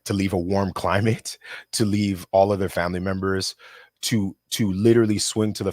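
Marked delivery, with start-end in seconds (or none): none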